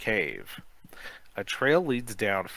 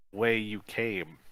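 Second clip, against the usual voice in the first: ordinary delivery, nothing marked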